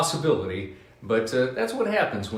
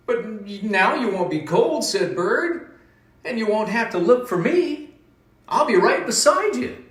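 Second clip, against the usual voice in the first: high, louder, comforting bird voice; reassuring bird; 11 semitones higher, 5 dB louder than usual. Acted. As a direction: cheer up a friend as a little bird.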